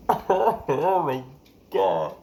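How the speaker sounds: loud gasped wail of embarrassment; mortified outburst. Retold or imitated. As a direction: blurt it out loudly, hammed-up horror. Mortified at being found out.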